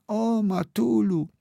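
slow, high, fading moan. A narrator's reading. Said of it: "jęczała nieszczęśliwa dziewczyna".

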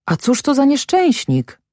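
high-pitched, startled question; exclaimed question. A narrator's reading. Guard asks the village head about the dead man, startled. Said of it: "zawołał jeden".

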